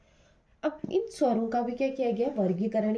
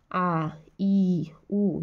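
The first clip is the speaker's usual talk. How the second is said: long vowels drawn out; much slower than usual. Shown as a demonstration. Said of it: "थोड़ा सा खींचना पड़ता है".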